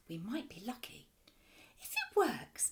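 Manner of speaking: soft hushed whisper. A narrator's reading. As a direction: hush it, hopeful. Hushed hopeful exchange.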